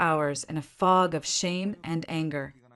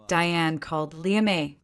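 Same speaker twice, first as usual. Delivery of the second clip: ordinary delivery, nothing marked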